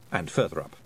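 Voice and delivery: ordinary delivery, nothing marked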